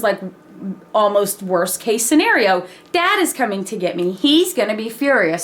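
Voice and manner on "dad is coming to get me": ordinary talk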